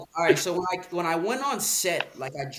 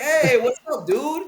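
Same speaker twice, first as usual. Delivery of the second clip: higher, louder chill dude greeting; Ryan's greeting; 7 semitones higher, 8 dB louder than usual. Retold or imitated. Ryan greeting him on set, higher and louder. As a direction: play the laid-back cool guy.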